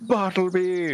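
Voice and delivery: high, gasping cry of joy; gasp, then cry out like a thrilled child; childlike delighted recognition. Acted. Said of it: "with childlike recognition, and he goes".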